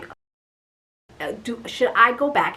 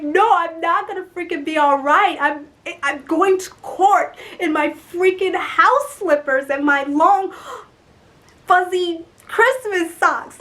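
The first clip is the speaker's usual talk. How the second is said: upset protest; 7 semitones higher, 5 dB louder than usual. Retold or imitated. Woman refusing comfort before court, upset loud whine. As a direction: protest in hammed-up despair. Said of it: "I'm just so upset".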